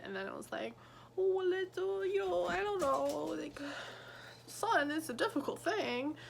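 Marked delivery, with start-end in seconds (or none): 1.2-6.2 a lightly voiced fatherly lecture, higher; imitating the dad's vague advice, pitched up; mock wise dad. Mocking the dad in the book.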